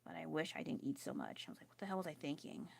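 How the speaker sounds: normal talking voice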